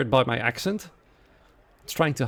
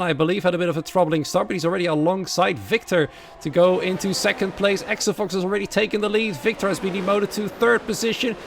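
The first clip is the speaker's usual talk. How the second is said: energetic, louder play-by-play call; live race commentary; 7 semitones higher, 7 dB louder than usual. Acted. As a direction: call every overtake as it happens. Live play-by-play after start.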